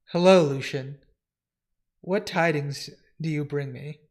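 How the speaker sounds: formal dialogue, grave lord and earnest servant; dramatic comic reading. Acted. Servant reporting census news to his lord, formal and grave. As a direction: read it as stately fantasy dialogue.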